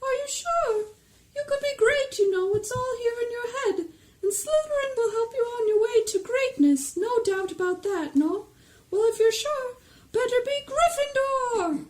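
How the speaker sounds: very high, squeaky persuading Sorting Hat; coax, then declare the verdict in a swooping high voice; weird high hat voice. Acted. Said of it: "that kind of weird high voice".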